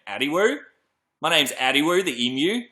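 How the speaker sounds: emu voiced in Australian, rising ends; Australian accent. Host voicing the newly named emu, Australian accent. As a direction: play the emu with an Aussie upward lilt. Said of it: "quite an Australian, uh, sounding name".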